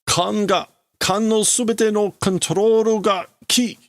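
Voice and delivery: high-pitched urgent report; acted alarm. Crewman telling the admiral about the controls, alarmed. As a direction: play a crewman's rising panic.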